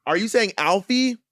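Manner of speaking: slow, high, rising to "Alfie"; stretched question lifting on "Alfie"; insistent checking. Acted. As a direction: query "Alfie" yet can't accept it. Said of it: "just keep saying the thing".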